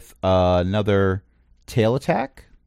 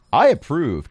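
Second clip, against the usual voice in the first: lightly voiced character, pitched a bit higher; a hint of a higher character voice; Sanctimonious; 5 semitones higher, 4 dB louder than usual. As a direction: pop in with a brief pronouncement. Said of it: "Sanctimonious shows up and says".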